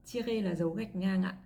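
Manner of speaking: normal talking voice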